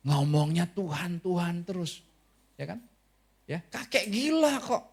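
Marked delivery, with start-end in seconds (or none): 3.4-4.8 mocking. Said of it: "mereka cuman bilang".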